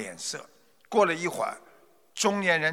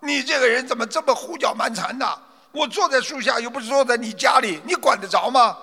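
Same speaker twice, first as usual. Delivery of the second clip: higher, fast, angry rebuke; scolding; 7 semitones higher, 3 dB louder, faster than usual. Acted. Furious merchant scolds the meddling stranger. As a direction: tell him off for pestering you. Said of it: "骂道".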